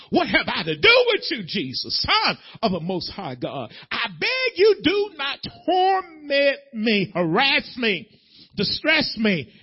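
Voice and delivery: demons' loud cry, acted out. Demons begging Jesus not to torment them, loud cry. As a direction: cry it out, climbing high and stretching the plea. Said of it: "They said it with a loud voice".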